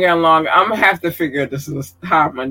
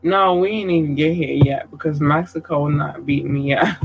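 ordinary talk

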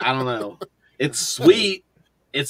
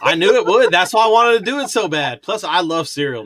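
excited; 6 semitones higher, 4 dB louder than usual. Real, not acted. Excited about his own list.

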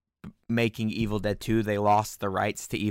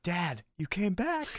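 slow, higher, hushed Jason voice; voicing Jason; 9 semitones higher, slower than usual. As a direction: play it like a startled kid.

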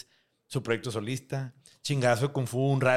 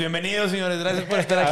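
loud welcome; 6 semitones higher, 6 dB louder, faster than usual. Acted.

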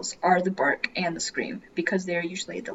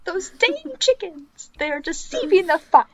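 high-pitched, hammed-up indignation; mock outrage; 11 semitones higher, 5 dB louder than usual. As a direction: blame the chickens in playful outrage. Joking outrage at cartoon chickens.

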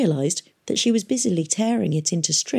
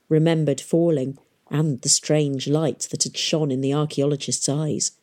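ordinary delivery, nothing marked

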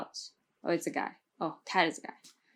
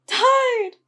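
much higher, louder wail; tearful wail; 14 semitones higher, 9 dB louder than usual. A narrator's reading. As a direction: cry out the death in anguish. Devet crying, announcing death.

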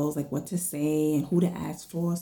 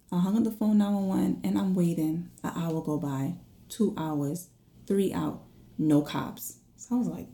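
ordinary delivery, nothing marked

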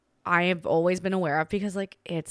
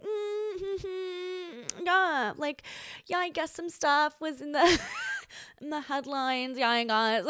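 awkward; 8 semitones higher, slower than usual. Retold or imitated. Awkward reply to ex.

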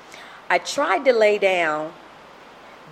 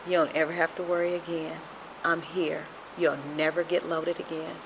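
softer and quick, a calm reassurance; a quiet promise that all is well; soft soothing voice of God; gentle divine voice; 2 semitones lower, 9 dB quieter than usual. Retold or imitated. Voice heard from the baby.

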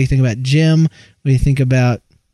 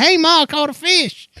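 put-on much higher, like an eight-year-old boy; excited kid calling to his mom; high child voice; 13 semitones higher than usual. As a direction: squeak it like a proud little kid. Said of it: "your voice is all high".

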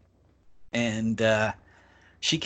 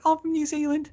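a much higher bright Kiwi voice; high chirpy friend; faster than usual. Retold or imitated. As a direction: pipe it up cheerfully.

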